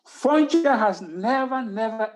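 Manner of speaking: slow, high, loud "Foncha has never, never"; drawn out, insistent "never, never"; emphatic never. Real, not acted. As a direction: lean hard on each "never". Insisting Foncha never sought reunification.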